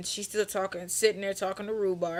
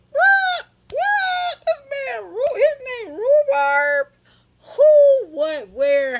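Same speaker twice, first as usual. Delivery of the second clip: very high, very loud screech of shock; shrieked disbelief; 12 dB louder, much slower than usual. Acted. Teller reacting to the man's name, shrieking in mock disbelief. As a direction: shriek it in hammed-up outrage.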